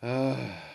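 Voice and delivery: long, drawn-out sigh. A narrator's reading. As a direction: let out a sigh. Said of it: "he sighed".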